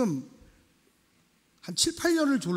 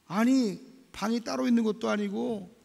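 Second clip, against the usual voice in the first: ordinary talk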